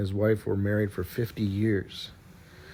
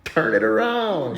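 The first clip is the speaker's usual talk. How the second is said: drawn-out, higher chorus line; everyone chorusing; 9 semitones higher, slower than usual. Acted. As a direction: stretch it out slowly like a whole group chanting. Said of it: "They all said".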